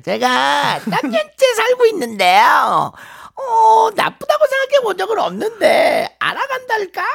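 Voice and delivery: high, disguised anonymous interview voice; squeaky altered voice of a secret girlfriend; voice changer. Pretend. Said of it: "따로 음성 변조해서".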